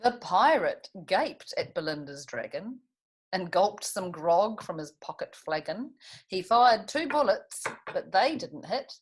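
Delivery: lively storybook rhyme reading; reading to children. A narrator's reading. Teacher reads Custard the Dragon to the class, lively storybook voice. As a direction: bounce the rhymes for little listeners.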